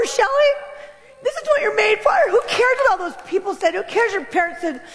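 put-on a wounded inner child pleading, voice high and breaking; little girl inside crying out. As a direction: cry it out like a trapped child begging to be free. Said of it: "The little girl in me was like".

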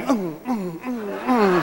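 soft, high little "mm" grunts; mimed bullet hits. Retold or imitated. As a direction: react to each pretend shot with a small hum. Mimes being hit by love bullets.

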